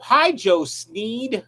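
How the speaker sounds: ordinary talk, nothing marked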